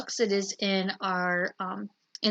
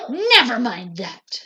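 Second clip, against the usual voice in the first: sharp, loud snap; snapped; 7 dB louder, slower than usual. A narrator's reading. Strict teacher brushing off the kids' question, loud snap. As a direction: cut them off curtly. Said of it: "snapped Miss Swamp".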